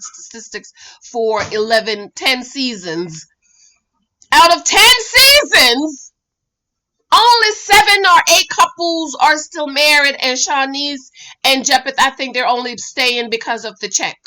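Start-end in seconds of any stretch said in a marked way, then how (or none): 4.3-10.2 emphatic. Real, not acted. Stressing the statistic loudly.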